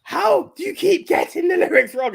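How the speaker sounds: imitating an exasperated outburst, high and loud; enraged. Partner snapping at the girl singing along, mock-enraged shout. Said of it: "her partner just gets enraged".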